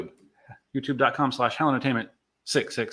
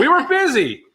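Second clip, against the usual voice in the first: laughing; 4 dB louder than usual. Real, not acted. Laughing repeat of cohost.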